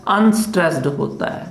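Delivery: normal talking voice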